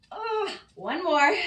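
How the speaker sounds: slow, high push through the last rep; drawn-out, rising effort; straining effort. Real, not acted. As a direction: drag it out under strain.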